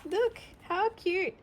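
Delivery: high cooing over a cute picture; high delighted coo at the page. Real, not acted. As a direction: coo it like you've seen a puppy. Coos at picture.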